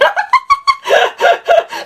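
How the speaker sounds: very high laugh; shrieking laugh. Real, not acted. Telling of AI attacks, high shrieking laugh.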